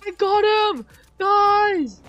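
high, elated yell with stretched words; real whooping excitement; triumphant shout. Real, not acted. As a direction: shout the news to everyone, thrilled. First kill in the game.

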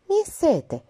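put-on high whiny little-boy plea; small boy tugging mother's dress, whimpering; whimpering child. A narrator's reading. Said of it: "scânci băiețelul".

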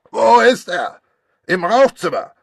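loud, high furious bellow; angry shout. Acted. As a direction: storm in roaring for Poirot. Granger bursts in shouting. (wütend gebrüllt, laut und hoch)

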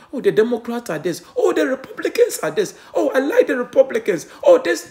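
high, loud, laughing parody of bickering voters; mock the squabblers in a silly high voice; mocking partisan voices. Preacher mocks party-loyal voters to his family, high and laughing.

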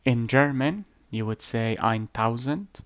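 normal talking voice

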